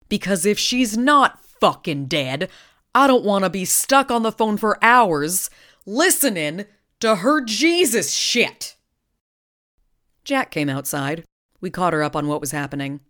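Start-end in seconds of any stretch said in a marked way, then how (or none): 0.1-8.7 exasperated outburst. Acted. Bobby's oomph returns, swearing.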